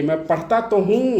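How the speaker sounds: teacher models the emphatic particle. Shown as a demonstration. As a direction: lean on तो as if insisting. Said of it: "तो" का मतलब है जोर देके".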